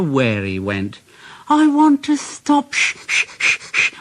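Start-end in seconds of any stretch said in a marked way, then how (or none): 1.5-2.7 high pleading engine voice; high weary whine; tired Percy. A narrator's reading. 2.7-3.9 whispered shh puffing rhythm; engine puffs. A narrator's reading.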